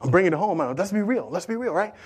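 usual speaking voice

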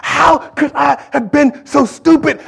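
high, slow, drawn-out self-scolding; anguished self-reproach. Acted. Preacher acting out Peter's thoughts, anguished self-reproach. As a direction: lament your own blunder with force. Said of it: "Peter was thinking".

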